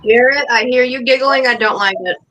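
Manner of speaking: ordinary delivery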